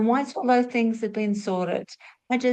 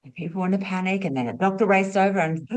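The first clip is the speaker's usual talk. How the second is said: usual speaking voice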